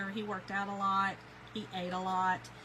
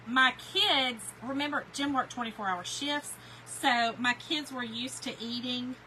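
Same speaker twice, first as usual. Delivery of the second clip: ordinary delivery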